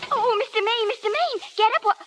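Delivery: high, urgent calling of his name; alarmed calling. Acted. Esther calls to the collapsed star in alarm. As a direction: call out to him in panic.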